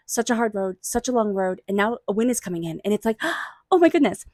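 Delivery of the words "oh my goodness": gasp, then a high, loud "oh my goodness"; excited gasp. Acted. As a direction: act out the thrill of good news. Acted delight at a win.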